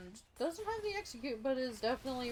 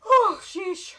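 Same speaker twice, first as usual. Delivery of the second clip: sudden loud high cry of shock; shocked shout; 9 semitones higher, 16 dB louder than usual. Real, not acted. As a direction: blurt it out in alarm. Caster reacts to sudden play.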